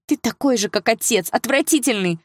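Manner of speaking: high-pitched outburst of disgust; crying out. A narrator's reading. Sister likens brother to their father, crying out. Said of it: "выкрикнула Саша".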